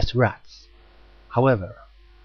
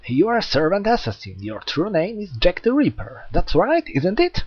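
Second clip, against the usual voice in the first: higher, quick eager check; eager questioning; 7 semitones higher than usual. Acted. Hyoma confirming Jack's identity, eager. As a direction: rush to confirm your guess.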